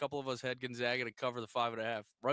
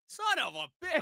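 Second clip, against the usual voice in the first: angry outburst; 5 dB louder than usual. Retold or imitated. Swearing at the TV hockey bet.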